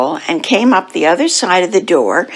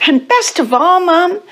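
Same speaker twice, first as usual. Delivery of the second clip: high, eager little-cat voice; excited child; 11 semitones higher than usual. Acted. Splat saving the best for Mum, high and excited. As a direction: build up to the best news, pitched high.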